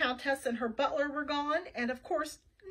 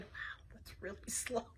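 hushed, unimpressed whisper; whispered aside; 5 semitones lower, 15 dB quieter than usual. Acted.

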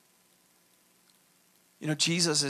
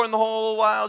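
ordinary talk

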